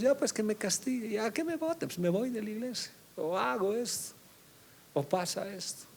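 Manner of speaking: high, flippant mock voice; careless believer. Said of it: "no entendemos".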